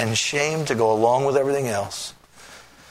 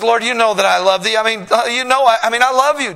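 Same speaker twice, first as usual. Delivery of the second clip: high, loud, stumbling reply; desperate answer; 9 semitones higher, 7 dB louder, faster than usual. Acted. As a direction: blurt it out desperately. Peter answers Jesus.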